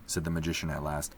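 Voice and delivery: ordinary delivery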